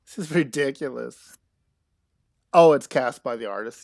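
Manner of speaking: normal talking voice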